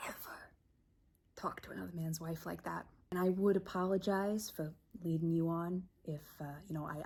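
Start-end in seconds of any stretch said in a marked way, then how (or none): 0.1-2.9 hissed whisper. Acted. Scolding her sister's husband, she whispers ever with menace.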